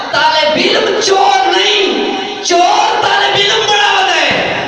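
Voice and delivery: high, slow shouted quotation; fiery preaching. Acted. Preacher quoting Imam Khomeini to the crowd, fiery slow declamation. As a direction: proclaim the saying slowly, at full voice.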